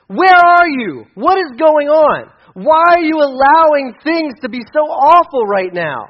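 slow, high, loud cry to heaven; pleading shout of a desperate prophet; anguished yell. Retold or imitated. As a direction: yell at God in despair, drawn out. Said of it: "He yells at God".

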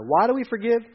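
ordinary talk, nothing marked

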